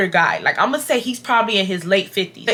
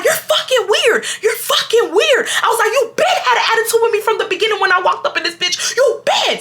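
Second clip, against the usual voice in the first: heated rant; 12 semitones higher than usual. Retold or imitated. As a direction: tear into him at the front desk. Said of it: "And I'm like".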